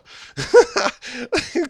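high-pitched giggling burst; gleeful laugh. Acted. Newly hired voice actor vows to show his wife, gleeful laugh. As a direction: cackle high and loud.

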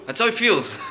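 ordinary delivery

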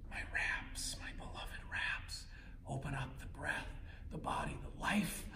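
soft breathy whisper. Acted. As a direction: whisper it like a secret. Whispered devotion to the pose.

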